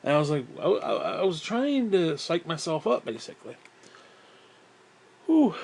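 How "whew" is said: high, loud puff of breath; winded whew. Real, not acted. Storyteller winded just from talking, puffed-out whew. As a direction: blow out air, out of breath. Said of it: "I'm getting, getting winded right now".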